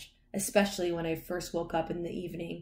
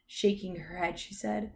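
ordinary delivery, nothing marked

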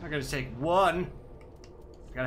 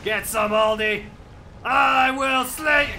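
high, loud, slow heroic war cry; hammed-up battle shout; 12 semitones higher, 10 dB louder, slower than usual. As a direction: roar it like a warrior charging in.

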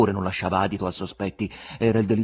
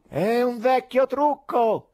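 higher, slow, louder scornful heckle; heckler from the gallery; 13 semitones higher, 5 dB louder, slower than usual. A narrator's reading. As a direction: jeer down from the balcony. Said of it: "si udì dal loggione".

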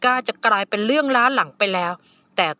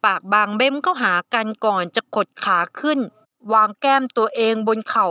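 plain reading voice

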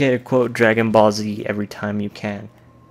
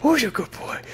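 put-on high cooing good boy; baby-talk praise for the dog; praising the dog; 7 semitones higher than usual. Player praises Dog meat with high baby talk.

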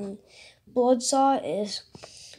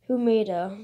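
ordinary talk

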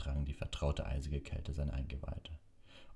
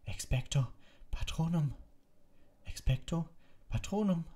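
shouted spell, climbing higher each time; desperate incantation; 13 semitones higher than usual. A narrator's reading. Harry casts against the Dementors, desperate and rising. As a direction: cry the spell out, straining. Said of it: "begann seinen Singsang".

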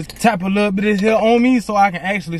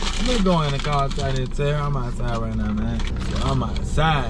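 put-on drunk; 7 semitones lower than usual. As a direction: play a tipsy driver, dragging words. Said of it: "I'm finna be acting like I'm drunk".

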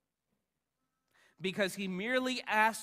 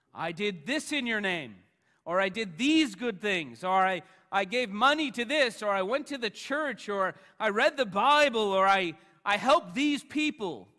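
loud, high-pitched list of boasts to God; self-justifying plea; 5 semitones higher, 12 dB louder than usual. Acted. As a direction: plead your good deeds, voice raised high.

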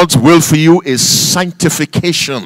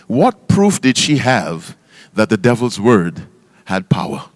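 ordinary talk, nothing marked

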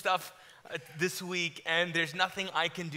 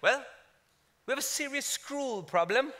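higher, put-on British complaint; mimicked British accent; 4 semitones higher, 4 dB quieter than usual. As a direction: put on British to match the clerk. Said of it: "with British people, you wanna talk like them".

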